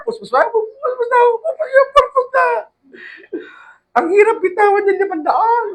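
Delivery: very high, strained comic whine; mock anguish. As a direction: wail it like losing a treasure. Mock anguish giving away money.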